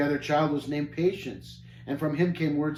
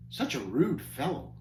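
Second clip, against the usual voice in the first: ordinary delivery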